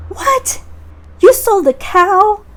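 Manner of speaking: high, loud disbelieving cry; shocked mom. Acted. Mother learning Jack traded the cow, shocked shout.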